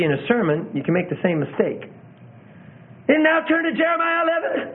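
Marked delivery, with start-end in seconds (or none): 3.1-4.7 much higher, every word pushed like a big proclamation; overdone emphasis. Shown as a demonstration. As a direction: preach a page number as if it were the climax. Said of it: "you put just as much emphasis on turning to Jeremiah eleven".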